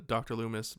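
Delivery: usual speaking voice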